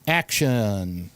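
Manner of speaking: ordinary talk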